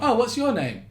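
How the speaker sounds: high-pitched character asking a name; acted question. Stranger asking someone's name, exaggerated curiosity. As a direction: pitch it up as an over-eager stranger. Sketch setting up the silly lyric.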